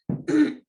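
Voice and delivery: sharp real throat clear; allergy throat clear before tea. Real, not acted. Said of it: "Excuse me".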